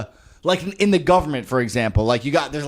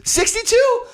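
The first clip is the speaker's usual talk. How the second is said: squeaky laughing disbelief, much higher; incredulous laugh; 11 semitones higher than usual. Real, not acted. Friend hearing the clerk's age, laughing in disbelief. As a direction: shriek the number in laughing disbelief.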